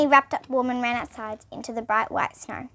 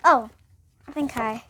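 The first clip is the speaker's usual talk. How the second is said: ordinary delivery, nothing marked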